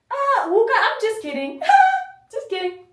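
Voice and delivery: high, loud fake-laughing kid; cartoonish child. Child covering up tears, high cartoon-like kidding. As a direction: flip to hammed-up giggly denial. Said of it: "He acts like a cartoon character".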